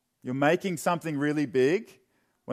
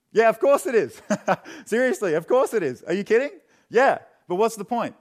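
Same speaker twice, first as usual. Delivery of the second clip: higher and louder, incredulous; emphatic agreement; 7 semitones higher, 6 dB louder than usual. Acted. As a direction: fire it back like it's obvious. Emphatic mock agreement, laughing.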